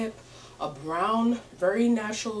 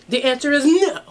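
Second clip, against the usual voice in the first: loud sassy no; 5 semitones higher, 10 dB louder than usual. Pretend. Emphatic sassy answer.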